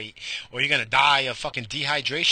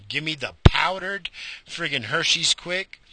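normal talking voice

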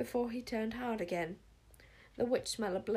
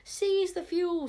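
high, loud, slow battle cry with SEIZE hit hardest; crowd scream; 10 semitones higher, 7 dB louder, slower than usual. A narrator's reading. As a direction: scream the order like a mob, leaning on SEIZE. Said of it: "screamed a whole crowd of magic hunters".

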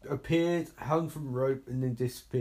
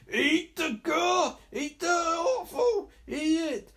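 high, drawn-out mock chant; gleeful egging on; 11 semitones higher, slower than usual. Viewer eggs on film zombie, high mock yelling. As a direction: cheer it on in a strained high yell.

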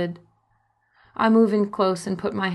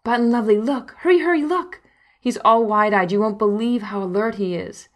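higher, louder eager calls; excited; 4 semitones higher, 5 dB louder than usual. Acted. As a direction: hurry her over, thrilled. Husband calls wife excitedly.

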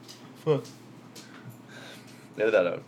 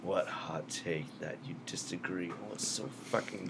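sexy voice; 2 semitones lower, 12 dB quieter than usual. Pretend. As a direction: drop to a sultry murmur. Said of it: "That's, that's a sexy voice".

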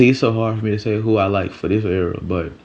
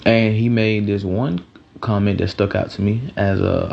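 ordinary talk, nothing marked

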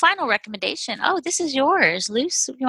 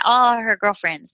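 normal talking voice

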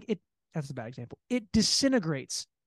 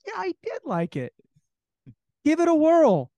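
higher, louder fake cheer; mock encouraging; 10 semitones higher, 7 dB louder than usual. Guest egging the girl on to try it, fake cheerful and high. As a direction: sell it with exaggerated enthusiasm.

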